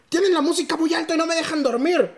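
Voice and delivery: vecino quejumbroso con voz aguda; modito golpeado. Retold or imitated. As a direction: pide la patrulla con tono exigente. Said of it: "tenía moditos golpeados". (el vecino pidiendo patrulla, con modito golpeado)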